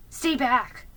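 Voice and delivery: short, urgent command; firm order. A narrator's reading. Ben pulling Felicity from the horse, firm order. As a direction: order her back sharply. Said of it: "he ordered".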